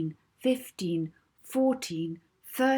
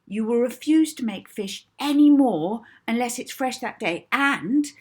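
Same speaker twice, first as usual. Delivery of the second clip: bossy command; 5 semitones higher, 7 dB louder than usual. Acted. Molly commanding hypnotised Edna.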